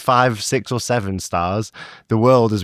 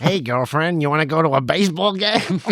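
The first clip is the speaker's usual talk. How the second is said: higher, hammed-up Chicago American voice; Chicago accent; 5 semitones higher than usual. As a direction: put on a broad American accent, breaking into laughter. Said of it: "Why are all your characters from Chicago?".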